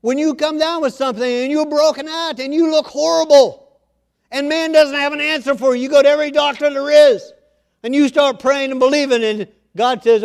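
loud, high, full-force sermon delivery; impassioned preaching. Real, not acted. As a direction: preach it out to the whole congregation, building in force.

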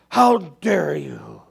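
very slow, loud, drawn-out indignation; imitating appalled parents, booming and drawn out; mock outrage. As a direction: stretch each word in hammed-up outrage. Acting out offended parents.

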